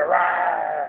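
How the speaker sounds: short playful roar; monster roar. Acted. Parent roaring along with a child, playful roar. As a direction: give a quick monster roar.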